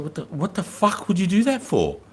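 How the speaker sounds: high-pitched disbelief, retold; incredulous objection. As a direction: question it like it's madness. Acted reaction to lieutenant's plan.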